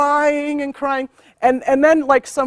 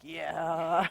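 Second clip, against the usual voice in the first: low, softer, relaxed drawl; lazy contented yeah; 6 semitones lower, 8 dB quieter than usual. Retold or imitated. Rabbit lying in the grass, contented and low. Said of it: "Rabbit's just all like".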